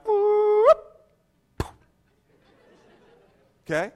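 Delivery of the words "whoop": high gleeful whoop; a smug high whoop of triumph; ego's whoop. Retold or imitated. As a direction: let the ego whoop up high. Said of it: "my ego just would have went".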